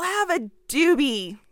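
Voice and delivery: slow, lazy mock stoner voice; mock stoner governor. Mocking the governor as a mellow stoner, slow and lazy. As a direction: drag it out like a stoned bro. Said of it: "So really what he's saying is".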